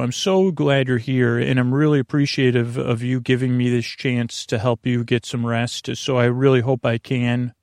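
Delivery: ordinary talk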